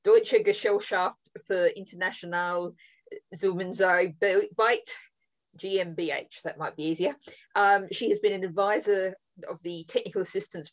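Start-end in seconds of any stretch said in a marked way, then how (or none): 0.0-6.4 German place names said the German way; careful German pronunciation, Zusammenarbeit slowed. Shown as a demonstration. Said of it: "apologize for my German accent here".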